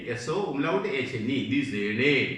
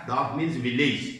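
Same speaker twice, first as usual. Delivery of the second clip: ordinary talk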